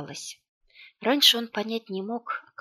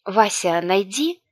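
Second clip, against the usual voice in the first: louder urgent order; raised, pressing voice; urgent call; 8 dB louder, slower than usual. A narrator's reading. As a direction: call out to send him off. Urgent order to little brother.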